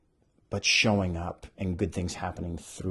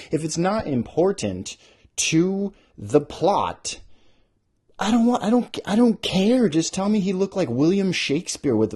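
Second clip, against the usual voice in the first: higher, louder exasperated rant; 7 semitones higher, 6 dB louder than usual. Real, not acted. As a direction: rant like you're fed up. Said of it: "ranting about descriptions".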